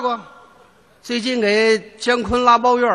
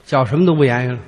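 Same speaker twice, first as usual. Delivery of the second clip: ordinary talk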